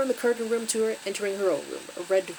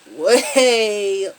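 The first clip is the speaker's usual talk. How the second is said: WAY stretched long and loud; drawn-out emphasis; 11 dB louder than usual. Real, not acted. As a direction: drag out "way" in loud exasperation. Stretches word for a dragging scene.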